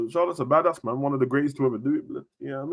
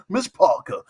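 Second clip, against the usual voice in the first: normal talking voice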